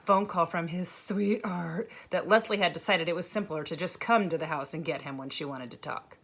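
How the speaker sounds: plain reading voice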